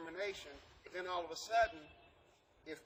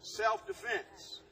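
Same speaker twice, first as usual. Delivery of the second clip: Self-defense drawn out slow and stressed; slow emphatic; 5 semitones higher, 4 dB louder, much slower than usual. Shown as a demonstration. Teacher revealing the key word to the class, slow and stressed. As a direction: lay out Self-defense slowly, each part weighted. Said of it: "You ready for it?".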